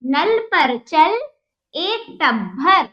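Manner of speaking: slow, word-by-word model reading; read-along drill. Shown as a demonstration. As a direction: stretch each word for beginners. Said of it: "तीन शब्द वाले वाक्य हम देखते हैं". (धीमे, एक-एक शब्द पढ़ाना)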